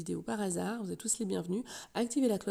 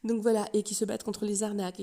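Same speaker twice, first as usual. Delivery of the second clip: normal talking voice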